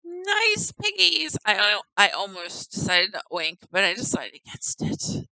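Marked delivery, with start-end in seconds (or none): 0.0-1.4 higher, slow coo at the pigs. Real, not acted. Player cooing at the game pigs, higher and slow.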